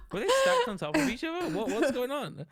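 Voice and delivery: high, loud and laughing in confusion; a bewildered laughing outburst; baffled, laughing. Retold or imitated. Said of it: "and I was like".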